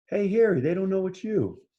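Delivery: high chirpy bird calling down. Acted. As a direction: call out high, as a little bird to a dog. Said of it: "That's what the bird's saying".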